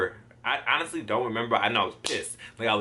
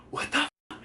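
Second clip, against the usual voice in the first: high, breathless mock shock; shocked exclamation; 13 semitones higher, 4 dB quieter than usual. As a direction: re-enact stunned disbelief. Retelling shock after crash.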